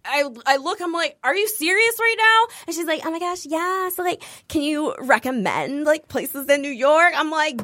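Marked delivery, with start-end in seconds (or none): 1.2-2.5 high-pitched mock disbelief; incredulous. Woken passenger reacting to the chatter, mock incredulous. 3.0-7.1 high start, slow drawn-out flirty question; mock gushing. Passenger asking pilot for New York tips, mock gushing.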